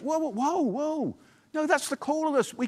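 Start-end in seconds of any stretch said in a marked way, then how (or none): 0.0-1.2 higher, startled whoa; lightly voiced surprise; mock alarm. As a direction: play the listener taken aback. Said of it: "You think".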